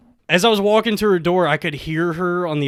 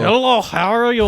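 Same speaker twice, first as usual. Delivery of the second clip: higher-pitched, hammed-up Russian voice; Russian accent; 5 semitones higher than usual. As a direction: practise a thick Russian greeting. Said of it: "can do a Russian voice".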